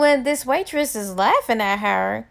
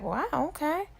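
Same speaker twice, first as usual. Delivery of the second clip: mildly shocked; 4 semitones higher, 5 dB quieter than usual. Acted. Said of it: "kinda shocked".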